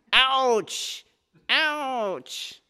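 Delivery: high, loud hammed-up ouch; mock cry of pain. Teacher acting out a student hammering with his bare hand, a hammed-up pain yelp. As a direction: yelp like you just hit your thumb.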